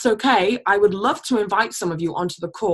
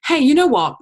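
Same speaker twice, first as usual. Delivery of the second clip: high, louder casual hook; higher, louder friendly opener; 8 semitones higher, 6 dB louder than usual. Shown as a demonstration. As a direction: sound casual and inviting. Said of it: "Be like".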